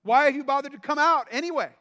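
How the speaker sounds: high loud scolding; preacher opening with an insult; shouted rebuke. Acted. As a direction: blast the crowd for coming. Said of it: "This is how he's starting the message".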